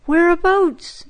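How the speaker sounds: mother anxiously asks her crying son where it hurts. A narrator's reading. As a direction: ask quickly, worried for her boy. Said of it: "she asked anxiously".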